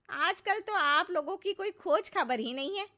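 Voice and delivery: ordinary talk, nothing marked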